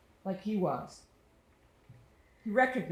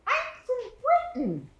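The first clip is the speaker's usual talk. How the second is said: put-on British boy's voice; 6 dB louder than usual. Little British boy asking the narrator about the game, high British accent. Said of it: "He had an accent. It sounded British".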